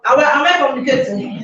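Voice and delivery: ordinary talk, nothing marked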